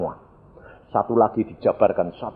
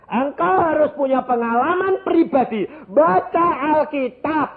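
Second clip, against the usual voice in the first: high-pitched, indignant preaching; impassioned preaching; 14 semitones higher, 7 dB louder than usual. Real, not acted. Preacher protests new believers being exalted too soon, impassioned preaching.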